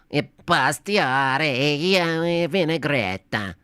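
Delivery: slow, drawn-out fake Italian gibberish; hammy Italian accent mangling the word; mock Italian accent. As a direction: ham it up like a stage Italian. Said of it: "in an Italian accent".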